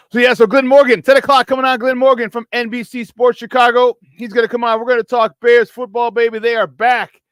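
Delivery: loud, high-pitched radio promo energy; pumped-up host plug; excited show hype. Real, not acted. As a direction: hype up the coming segment at full energy. Host hypes upcoming guest and football.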